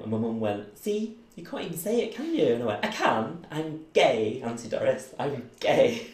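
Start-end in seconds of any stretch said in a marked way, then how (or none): 0.8-2.5 taunting challenge. Retold or imitated. Mum challenging him. 2.9-6.1 louder, higher, proud declaration; proud announcement. Retold or imitated. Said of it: "announced it, like, proud".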